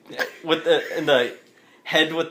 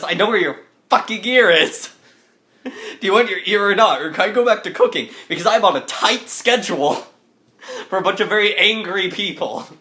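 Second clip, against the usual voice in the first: higher, scolding French cook voice; brisk, mildly exasperated chef's scolding; angry French lady chef; 5 semitones higher than usual. Acted. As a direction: fire it off like an irate French chef. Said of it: "angry French lady chef voice".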